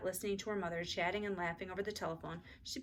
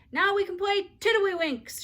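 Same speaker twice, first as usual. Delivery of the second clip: put-on high, loud, slow little-girl announcement; child announcing; 13 semitones higher, 10 dB louder than usual. A narrator's reading. Ramona announcing her game to her sister. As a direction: announce it proudly like a four-year-old. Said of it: "she announced".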